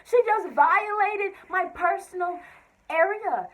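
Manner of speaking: loud, high outraged rant; indignant. Real, not acted. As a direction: blast the injustice. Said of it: "I was mad".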